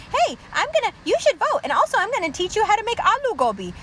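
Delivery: high-pitched mocking impression; Lily Singh. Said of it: "Lily Singh, too".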